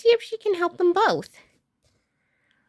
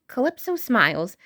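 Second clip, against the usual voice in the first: ordinary delivery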